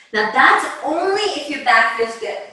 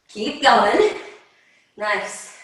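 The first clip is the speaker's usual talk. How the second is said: ordinary talk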